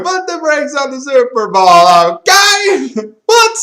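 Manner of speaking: very high, loud hype-man hollering; hammed-up rapper shout with stretched words and yelped ad-libs. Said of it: "went to a [name] thing".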